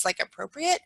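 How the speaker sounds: ordinary talk, nothing marked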